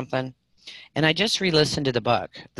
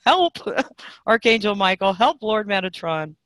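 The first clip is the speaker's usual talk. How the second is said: retold scream; 7 semitones higher, 3 dB louder than usual. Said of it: "I scream out".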